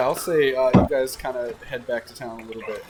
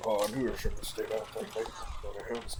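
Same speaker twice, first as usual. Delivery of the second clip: muttering; 5 semitones lower, 8 dB quieter, faster than usual. Acted. Bewildered farmer mutters to himself.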